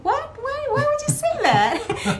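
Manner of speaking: shocked disbelief. Retold or imitated. Speaker retelling her reply to Michael, shocked and high. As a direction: recoil at his odd remark. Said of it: "I said".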